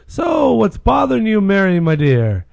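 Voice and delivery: loud, high-starting priest voice; priest's concerned question. Acted. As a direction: play a concerned priest, projecting loudly. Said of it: "Mary Clancy goes up to Father O'Grady".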